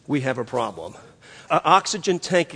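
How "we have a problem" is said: quiet, calm pilot report. Retold or imitated. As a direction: say it like a pilot who isn't rattled. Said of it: "this pilot calmly said".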